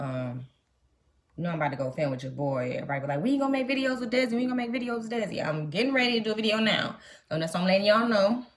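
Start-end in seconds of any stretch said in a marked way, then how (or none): 3.2-5.4 mimicking complainers. Mimics what everybody says about Desi.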